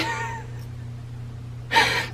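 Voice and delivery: high breathless laugh close to crying; embarrassed laugh. Real, not acted. Vlogger laughing nervously over her ripped jeans. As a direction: laugh it off so you don't cry. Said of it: "sorry, I'm laughing because when I get embarrassed".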